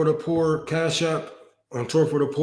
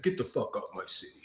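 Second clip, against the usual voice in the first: mock whisper; 8 semitones lower, 13 dB quieter, faster than usual. Mocking the mayor's words.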